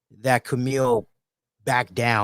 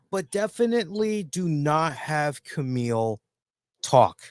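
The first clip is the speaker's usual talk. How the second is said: ordinary talk, nothing marked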